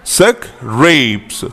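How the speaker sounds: सक, रेप्स split slowly into two syllables. Shown as a demonstration. Teacher showing students how to pronounce scrapes slowly. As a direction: break सक, रेप्स apart slowly for learners. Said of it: "ऐसे आपने pronounce करना है".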